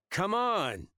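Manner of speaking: high, loud impatient shout. Acted. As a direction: yell across the house. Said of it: "the guy shouted".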